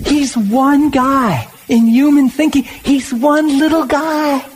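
high, slow, drawn out; a preacher marvelling at one small man; fervent preaching. Real, not acted. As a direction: stretch it out in wonder. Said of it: "preacher emotional emphasis".

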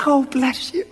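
high-pitched imitation of a grateful cashier; touched cashier. Preacher imitates the cashier blessing him, high and touched. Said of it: "But she's".

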